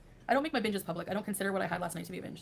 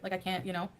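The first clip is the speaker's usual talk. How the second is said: usual speaking voice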